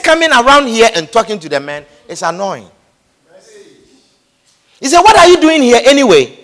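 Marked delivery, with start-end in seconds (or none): 5.0-6.4 louder scolding question; angry brother. Retold or imitated. Eliab confronting David, angry demand. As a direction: demand it with contempt. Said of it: "he was angry".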